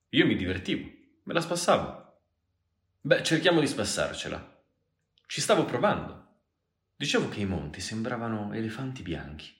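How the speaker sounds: high, loud, insistent retort; heated protest. Acted. Girl bickering with the man over drinks, protesting loudly.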